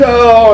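high drawn-out mock wail; mock crying. Wrestler entering the cage alone, mock crying. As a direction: wail it as if in agony. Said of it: "I'm crying".

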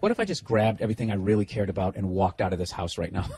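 Nia's imagined thought. Retold or imitated. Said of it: "I can tell by the side of her face".

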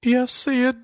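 high, cracking nervous reply; retold squeaky answer to the bishop. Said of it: "my voice is cracking".

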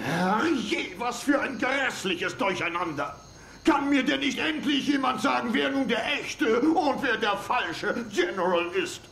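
frantic shouting with a nervous laugh at the end; exasperated shout. Acted. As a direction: throw up your hands and yell. General's outburst at the confusion.

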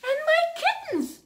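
very high, squeaky cat voice; a mother cat, very high and worried. Acted. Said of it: "said the cat".

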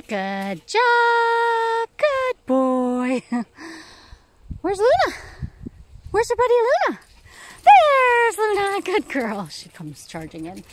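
high, loud sing-song praise for the dogs; cheering on tired hiking dogs in a high pet voice. Real, not acted. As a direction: gush over the dogs like they're babies.

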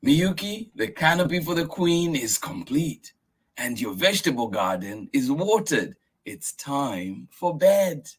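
high voice, slowing to bed; patient grandfather. Acted. Grandfather reminding Miyuki it's bedtime. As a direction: list the chores, then close firmly. Grandfather lists done chores.

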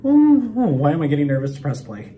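ordinary talk

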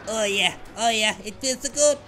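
slow, high, exaggerated oh yeah; mock bliss. Gamer hamming up bliss at his super, drawn out and high. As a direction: ham up the pleasure of the super.

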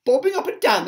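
high, loud echo; astonished cry. A narrator's reading. Crowd repeating the ladybird's odd notion, astonished cry. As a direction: cry it back in loud disbelief. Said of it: "they cried".

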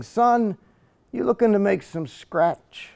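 a hint of the gruff old neighbor calling the boy over; neighbor's voice. Neighbor quoted in poem.

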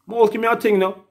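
high, pleading imitation of a beggar; mock beggar voice. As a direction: whine it up high like someone begging. Begging Jamaican quoted.

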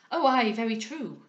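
loud, exclaimed agreement; hearty concession. A narrator's reading. Maurice admitting his mistake to Monckton, hearty and loud.

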